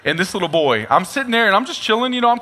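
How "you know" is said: ordinary talk, nothing marked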